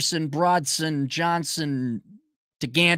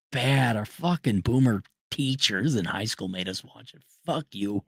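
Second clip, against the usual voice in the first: ordinary delivery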